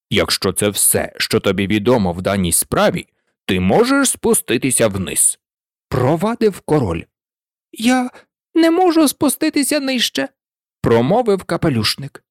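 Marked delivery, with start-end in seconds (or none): 0.1-5.4 lower, curt dismissal; sending the witness away; low, offhand order; low bored king. A narrator's reading. 7.7-10.3 higher, pleading objection; a kneeling man protesting; high, whiny reply; high plaintive hatter. A narrator's reading.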